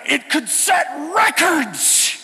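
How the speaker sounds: slow, loud, high mock alarm; friend freaking out. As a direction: stretch it out like a panicking friend. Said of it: "He's freaking out".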